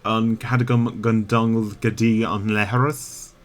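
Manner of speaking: "An Cadagum Gundungle Gadi an Leharas" in Irish sounds, rising at the end; Irish phrase. Shown as a demonstration. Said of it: "I can ask if I want to go to the toilet".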